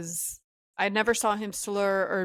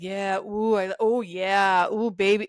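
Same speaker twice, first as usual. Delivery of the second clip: mock moaning; slower than usual. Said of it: "The moaning and groaning".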